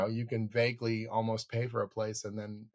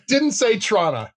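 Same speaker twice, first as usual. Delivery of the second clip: high, loud, amused verdict; mock damning review; 10 semitones higher, 9 dB louder than usual. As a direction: deliver a hammed-up one-line pan. Mock one-line film review.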